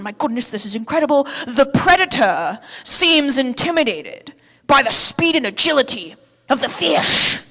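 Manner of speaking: loud, dramatic British documentary narrator; David Attenborough impression. Preacher quoting a nature documentary from memory, hammed-up British narrator. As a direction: awestruck British accent, drawing out the big moments. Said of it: "This is what Sir David Attenborough says".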